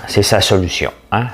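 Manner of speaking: usual speaking voice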